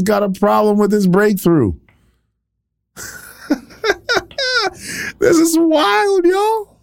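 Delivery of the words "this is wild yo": much higher, slow, voice cracking; preacher amazed at the crowd; incredulous cry. Real, not acted. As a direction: wail it out in disbelief. Preacher's exclamation, crying voice.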